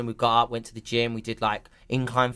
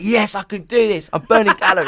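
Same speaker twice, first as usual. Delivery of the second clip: loud, higher-pitched mock jubilation; retold triumph; 5 semitones higher, 5 dB louder than usual. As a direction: cheer yourself on through the burpees. Retelling a self-congratulating burpee moment.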